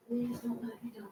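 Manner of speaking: desperate whispered prayer; whispered plea. Real, not acted.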